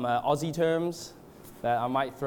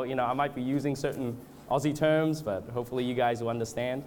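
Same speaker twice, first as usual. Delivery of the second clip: usual speaking voice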